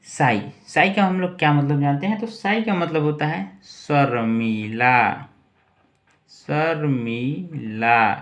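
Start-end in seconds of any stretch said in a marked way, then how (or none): 3.6-8.1 Sharmila drawn out as "sar-mee-laa"; slow dictation. Shown as a demonstration. Teacher writing what shy means, slow syllabic dictation.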